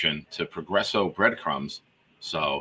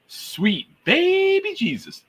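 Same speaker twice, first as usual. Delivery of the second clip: high, drawn-out exclamation; slower than usual. Acted. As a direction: squeal the name up high and slow. Reading out a funny name.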